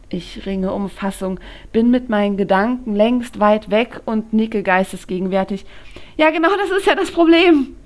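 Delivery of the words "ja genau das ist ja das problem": much higher, loud and fast; flustered blurt. A narrator's reading. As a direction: rush it out, rattled and distracted. Flustered Lou answers distractedly. (verwirrt herausgeplatzt, viel höher, laut und schnell)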